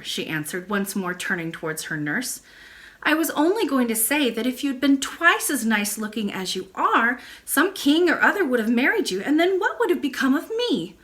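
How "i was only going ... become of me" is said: high, eager little princess voice; a hurt girl pressing her case; child princess. Acted. Princess insists to her nurse, high and eager.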